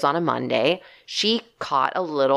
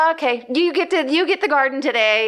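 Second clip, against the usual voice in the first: giving up to snake; 9 semitones higher than usual. Retold or imitated. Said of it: "and I'm like".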